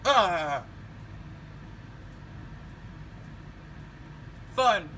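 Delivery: put-on loud high old man yelling. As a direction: yell over the alarm. Alarm screams again.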